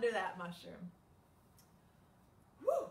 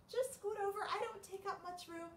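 bird voice; 11 semitones higher than usual. Acted. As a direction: coax them gently as the bird. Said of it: "said the bird".

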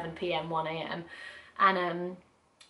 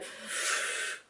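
long, airy exhale; long breath out; 4 dB quieter than usual. Shown as a demonstration. Showing how to breathe with gas and air, long exhale. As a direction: blow the breath out steadily. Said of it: "instead of being able to, like, go".

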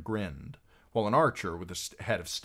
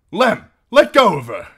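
high, very loud order; angry command; 13 semitones higher, 17 dB louder than usual. A narrator's reading. Harwin ordering Lem to free Arya, loud command.

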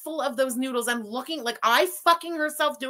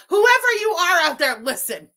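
calling out; 5 semitones higher, 6 dB louder than usual. Real, not acted. Threatening unseen viewer.

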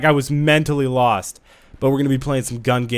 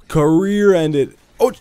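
mock dramatic; 5 semitones higher, 7 dB louder, slower than usual. Mock tragic after dying.

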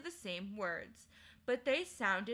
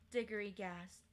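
ordinary delivery

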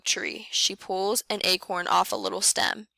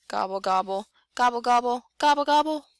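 building turkey gobble; 4 semitones higher than usual. A narrator's reading. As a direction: start plain and rise into a squawky turkey call.

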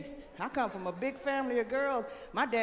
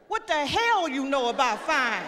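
louder grandma retort; 3 semitones higher, 7 dB louder than usual. Retold or imitated. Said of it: "you right".

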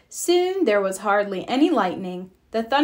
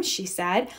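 plain reading voice

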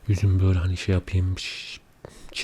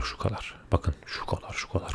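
quiet at night; 8 dB quieter than usual. Real, not acted. Showing tiny size, late night.

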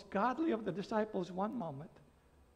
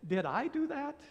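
higher-pitched mock-innocent question, voice rising at the end; Steve Urkel; 6 semitones higher, 3 dB louder than usual. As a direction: lightly imitate a bumbling sitcom character's catchphrase. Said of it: "and he would say".

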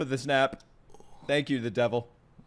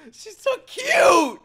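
high, slow, loud gush over SO; squealing she's so cute; 5 dB louder, much slower than usual. Real, not acted. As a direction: stretch it out in delight. Gushing over cute character.

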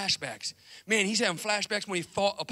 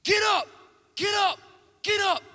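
high, loud repeated yell; desperate shout; 12 semitones higher, 5 dB louder than usual. Acted. Wife begging Rocky to rise, high shouting. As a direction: scream at him to get up. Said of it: "His wife comes over".

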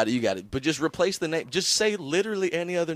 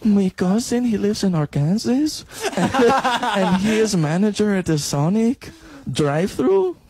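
higher, louder mock Mexican accent; put-on Mexican accent; 5 semitones higher, 3 dB louder than usual. Co-host bragging about cousin in Arkansas, mock Mexican accent. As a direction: brag slowly in a hammed-up Mexican accent.

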